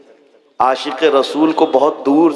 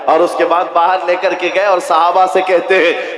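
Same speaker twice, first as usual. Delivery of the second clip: ordinary talk, nothing marked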